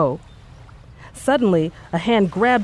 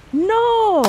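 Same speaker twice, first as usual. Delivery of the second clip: long high held scream; a high drawn-out scream of no; 4 dB louder than usual. A narrator's reading. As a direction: cry out as she dives. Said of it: "she heard him scream".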